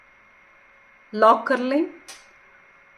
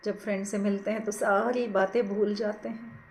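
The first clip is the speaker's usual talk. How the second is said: guided meditation; 4 dB quieter than usual. Acted. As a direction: ease them gently into watching the breath, unhurried. Leads a breath meditation. (धीमी, कोमल ध्यान कराने वाली आवाज़)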